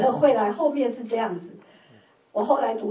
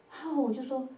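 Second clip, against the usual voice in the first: ordinary talk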